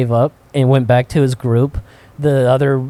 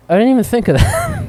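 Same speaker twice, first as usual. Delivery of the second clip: higher, sheepish dad voice; 9 semitones higher than usual. Retold or imitated. Dad admitting he never considered the danger, higher voice. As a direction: play the oblivious dad, realizing late. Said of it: "my dad's like".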